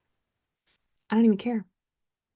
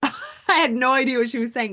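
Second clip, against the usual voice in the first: laughing; 6 semitones higher than usual. Real, not acted. Vlogger laughing at her younger self, fast. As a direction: talk through real laughter, higher and faster.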